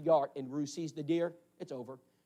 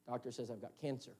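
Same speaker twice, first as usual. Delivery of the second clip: hushed, grave; 7 semitones lower, 12 dB quieter than usual. Retold or imitated. Crying man confides diagnosis.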